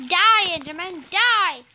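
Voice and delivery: loud taunting yell. Real, not acted. Player yelling at the Enderman to die. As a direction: shout him down.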